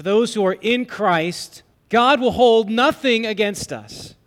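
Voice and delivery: loud, high, driving sermon voice; full-force preaching. Acted. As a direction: preach it out with fire. Preaching climax.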